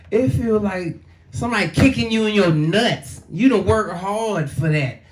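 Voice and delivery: ordinary talk, nothing marked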